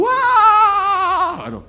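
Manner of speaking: high drawn-out wailing; mock wail. Preacher imitating his daughter's drawn-out crying, high and slow. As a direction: stretch the cry out slowly.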